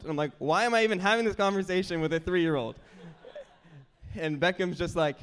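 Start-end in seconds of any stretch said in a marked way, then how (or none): 0.4-2.8 loud, fast, higher-pitched exasperation; playing up a baffled dad's disbelief; exasperated. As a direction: rattle it out in mock exasperation. Retelling exasperation at arguing with toddler.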